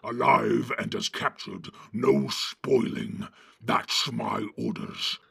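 slow, forceful orc commander; deep, drawn-out orc growl. A narrator's reading. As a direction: lay down orders in a slow growl. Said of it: "said the deep voice".

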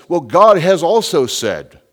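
mocking quote. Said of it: "they want to tell us".

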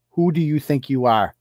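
usual speaking voice

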